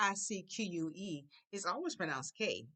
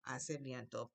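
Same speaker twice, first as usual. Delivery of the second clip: softer repeat; 5 semitones lower, 6 dB quieter, slower than usual. Shown as a demonstration. Teacher repeats the phrase quietly for the class. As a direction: lower and softer "Hace viento". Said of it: "I say".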